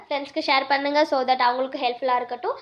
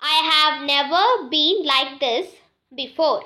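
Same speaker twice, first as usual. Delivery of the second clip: careful, drawn-out repeat for learners; slow it right down so students can follow; very slow model sentence; much slower than usual. Shown as a demonstration. Teacher repeats the sentence for students, very slow. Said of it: "முன்னாடி".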